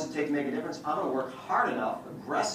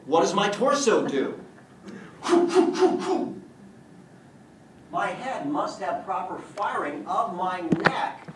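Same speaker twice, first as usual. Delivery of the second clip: animated demo; 7 semitones higher, 5 dB louder than usual. Shown as a demonstration. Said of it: "demonstrating head movement".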